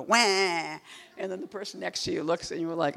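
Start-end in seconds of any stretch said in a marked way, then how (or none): 0.0-0.8 drawn-out mock wail; involuntary-sounding comic cry; imitated wail. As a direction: burst out with a sudden wah. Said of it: "suddenly you go".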